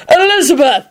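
high, happy call across the room; a high cheerful hail; cheery shout. Acted. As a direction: call her over gladly. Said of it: "he shouted cheerily".

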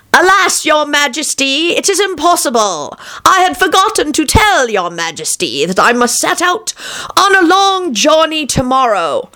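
high-pitched, wheedling excuse to a king; pleading charlatan. A narrator's reading. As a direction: play a trickster begging off.

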